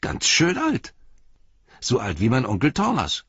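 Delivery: a lad amazed at the age; boy's voice. A narrator's reading. Boy tells Pippin he is old, in a high boy's voice.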